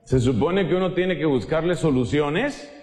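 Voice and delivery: usual speaking voice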